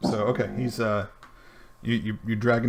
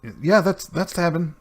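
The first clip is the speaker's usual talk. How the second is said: nervous captive informant; 8 semitones higher, 6 dB louder than usual. Acted. Captive answers the player in character.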